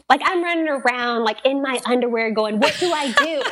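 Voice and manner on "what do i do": higher, flustered retold panic; comic, helpless fluster; mock panic. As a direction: act out panicking, half laughing. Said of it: "I'm, like, panicking".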